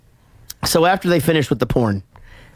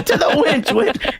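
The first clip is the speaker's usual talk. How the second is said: mock wench call; 10 semitones higher, slower than usual. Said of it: "keeps calling her a wench".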